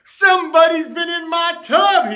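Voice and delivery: high, loud outraged holler; bellowing through the house. Acted. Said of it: "he hollered".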